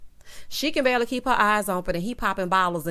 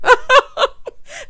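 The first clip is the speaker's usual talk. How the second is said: loud high laugh; 12 dB louder than usual. Real, not acted. Laughing at parents fighting.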